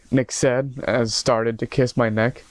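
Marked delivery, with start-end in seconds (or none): none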